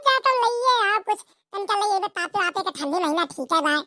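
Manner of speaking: normal talking voice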